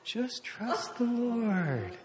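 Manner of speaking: very slow, soft, hushed; calm reassurance. Retold or imitated. Wife gently repeating to her panicking husband, slow and soft. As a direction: draw it out soothingly, near a whisper. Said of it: "She's going".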